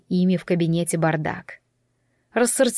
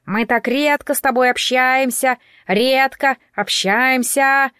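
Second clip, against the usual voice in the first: high, raised parrot voice repeating overheard words; mimicking bird, pitched up and drawn out; squawking parrot; 5 semitones higher, 3 dB louder, slower than usual. A narrator's reading. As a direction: parrot it back, slowing and stretching the words. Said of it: "во всю птичью глотку крикнул попугай".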